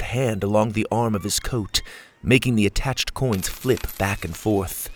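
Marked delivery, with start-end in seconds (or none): none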